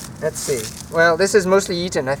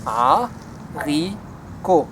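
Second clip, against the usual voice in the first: haricot drawn out slowly, syllable by syllable; slow spelled-out haricot for learners; much slower than usual. Shown as a demonstration. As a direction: stretch haricot into three clear syllables. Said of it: "It's just three syllables".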